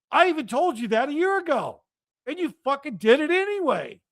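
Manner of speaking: high-pitched exasperated tirade; incensed. Retold or imitated. Scolding a friend who got the shot despite warnings, incensed. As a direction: re-enact berating a friend, pitch staying up. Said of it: "I was so incensed".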